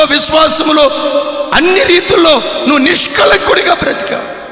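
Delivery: loud preacher voice, rising; preaching. Acted. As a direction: preach it at full force. Preacher retells angel dialogue. (బోధకుని ఉత్సాహపు స్వరం)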